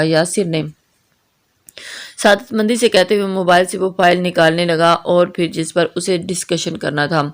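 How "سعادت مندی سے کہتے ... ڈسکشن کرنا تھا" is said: usual speaking voice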